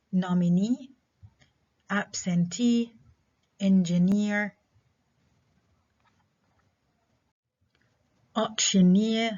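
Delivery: nominee, absentee, engineer, auctioneer, each hit on its final syllable; stress last syllable. Shown as a demonstration. Teacher listing examples, nominee to auctioneer stressed on the endings. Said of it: "stress on the last syllable".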